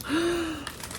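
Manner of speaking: sharp high gasp of wonder; a quick amazed intake of breath. Pretend. Child-book wonder, gasping.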